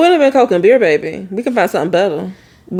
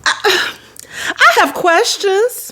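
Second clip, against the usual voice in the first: high, slow disbelief; pitched up, stretched out; incredulous; 10 semitones higher, slower than usual. Real, not acted. Incredulous reaction.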